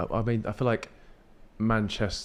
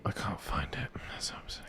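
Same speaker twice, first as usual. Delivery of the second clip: whisper; 5 semitones lower, 11 dB quieter than usual. Real, not acted. Aside while searching phone.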